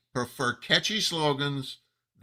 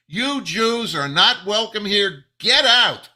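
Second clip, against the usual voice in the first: louder, slow menacing words, building to a yell; hostile threat; 7 semitones higher, 9 dB louder, slower than usual. Retold or imitated. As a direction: spit it out like a hateful mob. Voicing a hostile sign's message.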